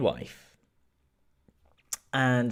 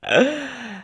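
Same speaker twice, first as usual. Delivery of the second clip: surprised gasp; 11 semitones higher than usual. Real, not acted. Reacting to school playing video.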